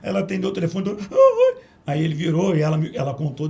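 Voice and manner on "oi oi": imitated loud, high-pitched sobbing hello; crying. Crying secretary answering [name] on the phone, mock wail. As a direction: mimic a wailing phone greeting. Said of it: "ela desabou de chorar".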